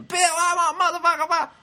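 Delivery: very high, loud garbled screech; imitated drunken yelling. Drunk heckler still yelling at Bill, screeched imitation. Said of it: "She's still yelling".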